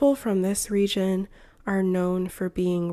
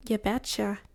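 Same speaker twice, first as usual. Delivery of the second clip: a hint of friendly Midwest accent. Shown as a demonstration. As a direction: lightly demonstrate a Midwestern US accent. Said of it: "Then there's the Midwest accent".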